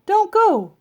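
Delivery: high, loud call. Acted. Andrew calls to the workers backing away, high and loud. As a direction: call after them before they leave.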